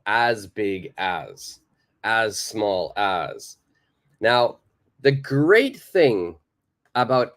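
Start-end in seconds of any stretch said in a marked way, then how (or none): 0.1-3.6 as big as, as small as, said slowly word by word; slow model phrase. Shown as a demonstration. Teacher modelling the as pattern for students, slow and deliberate. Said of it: "adjective as".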